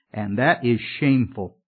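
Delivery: ordinary talk, nothing marked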